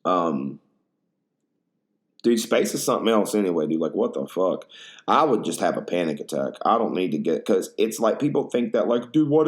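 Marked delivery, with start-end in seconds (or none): none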